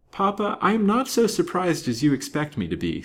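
put-on high-pitched, frank young girl. A narrator's reading. Young girl answering her papa's astonishing news, frank.

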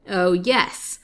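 loud gloating exclamation; triumphant old man's outburst; crying out. A narrator's reading. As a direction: crow over the spoils. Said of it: "cried the old man".

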